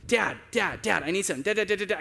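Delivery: rapid-fire repeated calls for a parent's attention; pestering daughter. Retold or imitated. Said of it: "let's just say she was persisting".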